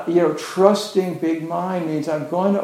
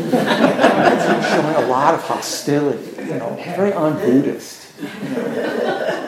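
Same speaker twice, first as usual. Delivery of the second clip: laughing. Real, not acted. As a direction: talk through real laughter. Laughing at people's mock disapproval.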